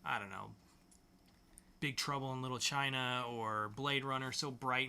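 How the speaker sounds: usual speaking voice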